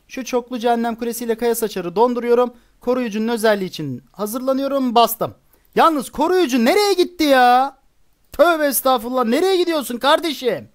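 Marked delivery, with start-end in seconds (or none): 5.7-10.7 exasperated outburst. Real, not acted. Gamer scolding his wandering guardian, exasperated. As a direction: protest in raised-pitch disbelief. Troop walks off wrong way.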